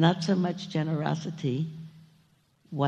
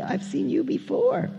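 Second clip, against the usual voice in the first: high, lightly acted astonishment; surprised bird, pitched up; storybook gasp; 10 semitones higher than usual. A narrator's reading. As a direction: recognise someone with a mock start. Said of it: "gasped Maisie".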